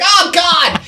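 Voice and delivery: high, squealing mock disgust at the toe; disgusted oh god. Reacting to gnarly toe.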